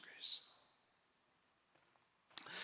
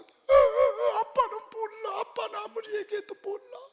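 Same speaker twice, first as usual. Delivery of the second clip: put-on high, slow tearful little child; crying child; 6 dB louder, slower than usual. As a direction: plead like a small child crying for dad. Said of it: "우는 거야". (아빠를 붙잡고 우는 아이처럼 높고 느리게 울먹이며)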